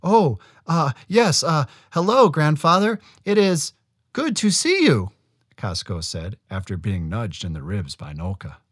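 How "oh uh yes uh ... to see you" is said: high, hesitant, frightened; nervous stammer. A narrator's reading. Nervous boy greets the monster.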